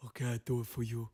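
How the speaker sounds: put-on botched Dominican accent; bad Dominican accent. Comedian mocking his own attempt, bad Dominican accent. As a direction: have another clumsy go at Dominican. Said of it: "how to do a Dominican accent".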